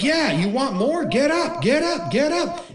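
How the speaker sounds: high, pumped-up chant; hyping the crowd. Acted. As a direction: play a coach whipping up the crowd. Acts coach hyping up cheering crowd.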